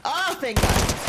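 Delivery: high, loud, slow cry of relief; relieved scream. Real, not acted. Streamer surviving the fight, yelling her relief. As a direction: wail it out with relief. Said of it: "I'm screaming".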